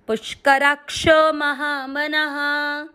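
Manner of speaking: slow chanted recitation of the verse; measured devotional recitation. Acted. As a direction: intone the line slowly.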